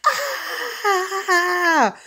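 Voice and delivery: long high-pitched scream; high drawn-out "Ah!" scream; terrified scream. Retold or imitated. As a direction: let out a long imitated scream of terror. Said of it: "he just starts screaming".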